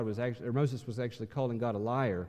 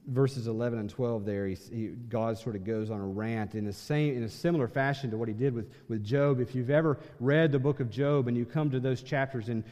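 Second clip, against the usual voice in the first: ordinary talk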